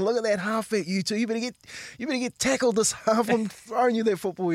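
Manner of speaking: high, mock-stern yell at the centres; halftime scolding. Halfback scolding teammates in the change room, high mock yell. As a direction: bark at the lazy centres from the shower. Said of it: "I'm going".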